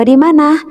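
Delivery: "Di mana?" lifted high as a question; rising question tune. Shown as a demonstration. Teacher models the question word for pupils, asking tune. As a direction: ask "Di mana?" with a bright high question lift. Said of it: "dibaca dengan intonasi yang tepat".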